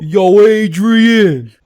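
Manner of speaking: very slow, loud Rocky call; Rocky impression. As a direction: bellow it slowly like Rocky calling for Adrian. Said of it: "Rocky Balboa".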